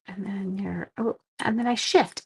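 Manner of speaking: whispered reminder to herself. Real, not acted. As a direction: breathe it out as a private note. Reminding herself mid-craft.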